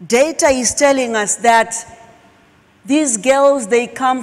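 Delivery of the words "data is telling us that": ordinary talk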